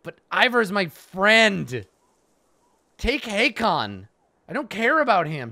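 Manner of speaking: high loud protest, slow and drawn out; pleading shout. Acted. Hero begging to keep Ivor, loud pleading shout. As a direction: yell it like a child refusing to let go. Voicing protagonist protesting loudly.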